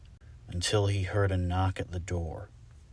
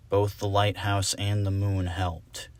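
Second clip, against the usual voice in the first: ordinary delivery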